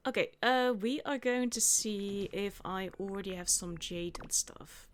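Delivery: ordinary delivery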